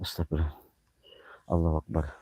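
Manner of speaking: praying under his breath while spooked; scared prayer. Real, not acted. Frightened murmured prayer.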